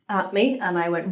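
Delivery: ordinary delivery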